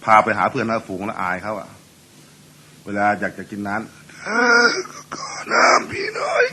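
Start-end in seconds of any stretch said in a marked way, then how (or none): 4.0-6.4 very high, strained, breathy croak; hoarse husband voice. Shown as a demonstration. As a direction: squeeze the words out as a cracked hoarse rasp. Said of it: "เสียงมันอย่างนี้เลยนะ". (เสียงแหบมากของผัว)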